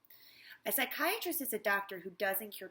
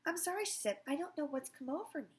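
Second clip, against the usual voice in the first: a little confused; 6 semitones higher than usual. Acted. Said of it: "a little confused".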